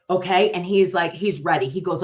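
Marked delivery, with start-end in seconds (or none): none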